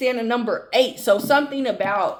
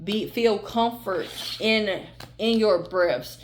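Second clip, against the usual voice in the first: calm tender closing blessing, softer; soothing reassurance; 5 semitones lower, 4 dB quieter than usual. Acted. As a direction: ease them into peace, gently and softly. Closing reassuring message to viewer.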